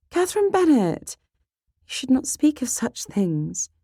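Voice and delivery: high, reproving big-sister voice; prim, shocked rebuke; scandalised scolding. Acted. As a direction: reprimand her by full name, pitched high. Sister scolds Kitty by full name.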